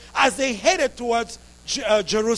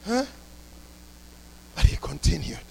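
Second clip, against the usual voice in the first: ordinary delivery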